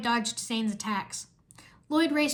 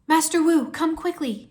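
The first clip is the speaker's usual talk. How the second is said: high, loud urgent call; urging; 5 semitones higher, 6 dB louder than usual. A narrator's reading. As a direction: summon the master in a hurry. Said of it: "she urged".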